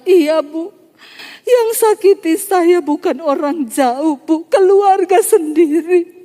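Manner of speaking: high, drawn-out mock weeping voice; imitated crying. Hurt woman confesses family wounds to counselor, mock tearful. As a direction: wail it slowly, imitating her tears. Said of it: "Tiba-tiba dia mulai menangis".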